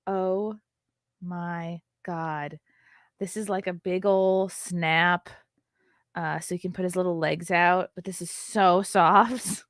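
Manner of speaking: ordinary delivery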